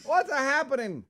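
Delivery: put-on high, nasal Brooklyn accent; big Brooklyn greeting. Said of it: "Sometimes I wish I had a Brooklyn accent".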